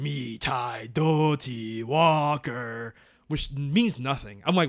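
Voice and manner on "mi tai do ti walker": very slow, low, far-off chant of "Mi tai do ti walker"; a ghost calling from deep in the house; eerie distant voice. Retold or imitated. Retelling the haunted house ghost calling out, slow eerie chant. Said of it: "a voice going".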